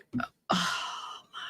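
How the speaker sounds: whispered oh my, trailing off; stunned whisper. Real, not acted. Host reacting to the eighteen hours detail, stunned whisper. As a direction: let it slip out under your breath.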